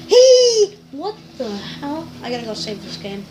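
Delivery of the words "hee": high squeal. Real, not acted. Squeals after his voice crack.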